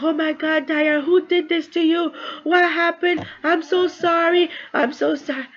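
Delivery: very high, anguished acted cry of concern; emotional. Stephanie finding her hurt sister, acted out emotional and high-pitched. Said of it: "that scene was so emotional".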